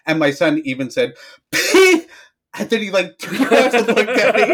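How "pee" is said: put-on high, loud child yelp; blurted kid word. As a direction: blurt it like a cheeky little boy. Son blurting a rude word.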